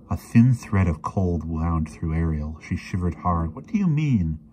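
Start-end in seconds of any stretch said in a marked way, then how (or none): 3.5-4.4 high-pitched, bewildered question; confused, voice lifted; baffled question. A narrator's reading. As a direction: ask it utterly at a loss. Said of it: "she asked, baffled".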